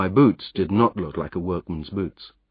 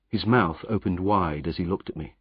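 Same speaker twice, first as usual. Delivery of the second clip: plain reading voice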